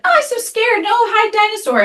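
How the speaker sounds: put-on high little-girl voice, frightened and whiny; tiny scared child pleading; scared toddler. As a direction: play the terrified two-year-old begging. Toddler daughter scared of dinosaur.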